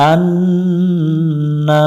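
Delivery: আন্না held long through the nose on ন্ন; ghunna nasal hold. Shown as a demonstration. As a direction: hum the ন্ন of আন্না slowly. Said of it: "গুণনা করে নিব". (আন্না নাকে গুণনা টেনে)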